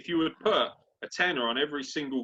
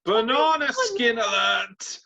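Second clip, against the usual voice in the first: slow, high, loud alarm call; sounding the alarm, hammed up; mock alarm; 9 semitones higher, 8 dB louder, slower than usual. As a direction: ring the alarm like a siren. Mock alarm over upset pick.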